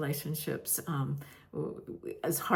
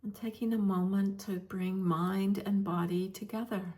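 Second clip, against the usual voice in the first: guided meditation. Real, not acted. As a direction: gently guide listeners into stillness, leaving space between phrases.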